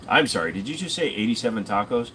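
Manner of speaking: normal talking voice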